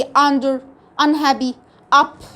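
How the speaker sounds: "under، unhappy، up" with a short "uh". Shown as a demonstration. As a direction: open each of "under، unhappy، up" on a brief "uh". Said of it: "هو صوت الشورت بتاعها". (نطق "under، unhappy، up" بصوت "أَ" قصير)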